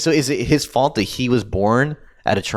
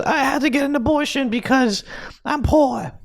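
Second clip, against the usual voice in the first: put-on Brooklyn accent, pitched much higher; 12 semitones higher than usual. Poor mother explaining her abortion, in a much higher put-on Brooklyn accent. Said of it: "That was a Brooklyn accent".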